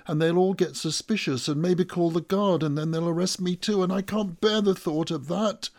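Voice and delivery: loud, high, breakneck worry; panicked rush, pitched high; rising panic. A narrator's reading. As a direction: let the fears tumble out in one run. Narrator panics at imagined arrest.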